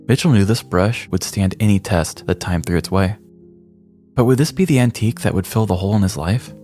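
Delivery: normal talking voice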